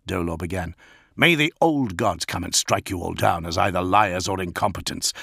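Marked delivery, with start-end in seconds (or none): none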